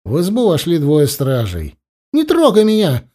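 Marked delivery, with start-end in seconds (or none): 2.1-3.1 put-on high, restrained shout of a boy; crying out. A narrator's reading. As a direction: cry out in protest, pitch jumping up. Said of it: "закричал мальчик". (вскрикивая, как мальчик)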